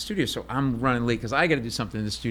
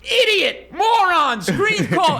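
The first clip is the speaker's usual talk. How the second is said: self-berating outburst; 7 dB louder, much slower than usual. Retold or imitated. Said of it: "this is what you would see".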